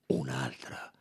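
ordinary delivery

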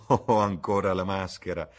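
loud laugh leading into the words. A narrator's reading. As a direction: laugh out, then say it amused. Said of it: "La duchessa rise". (ridendo forte)

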